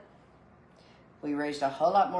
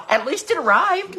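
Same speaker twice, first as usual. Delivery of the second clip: laughing; 11 semitones higher, 7 dB louder than usual. Real, not acted. Laughing consolation.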